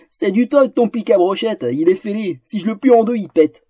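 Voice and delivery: a hint of a mocking troll; lightly voiced troll. Troll taunts the fencer about his weak sword, mildly mocking. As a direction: sneer at the flimsy blade.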